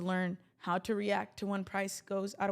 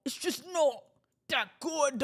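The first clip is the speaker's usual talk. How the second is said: hammed-up British accent, pitched higher; 5 semitones higher, 5 dB louder, slower than usual. Shown as a demonstration. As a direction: put on an exaggerated British voice, drawing it out. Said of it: "Y'all want to hear my British accent?".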